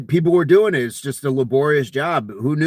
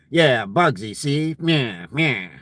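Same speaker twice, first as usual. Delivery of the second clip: nyah-see tough guy patter; G Edward Robinson; slower than usual. Acted. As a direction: toss off a movie mobster aside. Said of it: "the old-timey accent, the G Edward Robinson".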